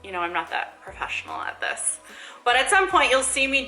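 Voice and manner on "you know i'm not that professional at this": whispered aside. Real, not acted. Self-deprecating aside to camera.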